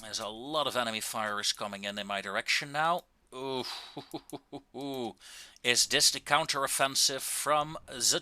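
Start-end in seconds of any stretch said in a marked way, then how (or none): 3.7-5.1 soft laugh. Real, not acted. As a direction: chuckle softly under incoming fire. Enemy fire coming his way.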